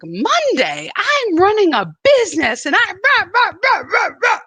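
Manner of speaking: high, loud exasperated ranting; hammed-up fury at the phone company; mock angry rant.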